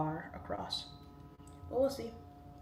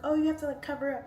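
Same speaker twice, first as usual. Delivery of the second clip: high, loud mocking tone; lightly mock a preachy critic; 8 semitones higher, 6 dB louder, faster than usual.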